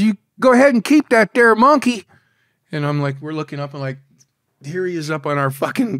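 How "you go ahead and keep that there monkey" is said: high, loud mock Dutch accent. Dutch captain yelling over the rail to smugglers, loud imitated Dutch accent. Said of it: "he's yelling over the rail".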